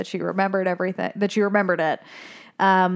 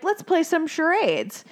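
cheery fish; 8 semitones higher than usual. Retold or imitated.

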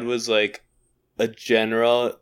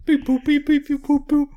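toy robot voice. Pretend. Acting out a voicemail beep.

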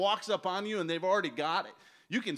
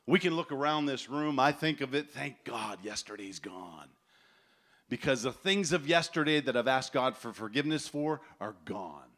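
ordinary talk

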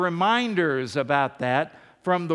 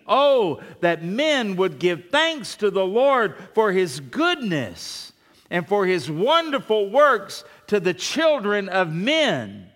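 exclamatory reading; 3 semitones higher, 4 dB louder than usual. Real, not acted. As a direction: read it like it ends in an exclamation point. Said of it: "it's got an exclamation point".

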